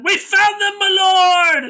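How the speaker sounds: high, loud hammed-up soldier yelling; mock soldier shout. Soldier reporting to his lord, hammed-up high shout. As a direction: squeal it like a panicked guard. Soldier voiced in retold scene.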